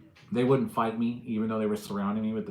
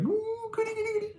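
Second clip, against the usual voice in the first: much higher, squeaky "goody, goody"; childish delight at a break-in; mock glee at a burglar; gleeful. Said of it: "I got all excited".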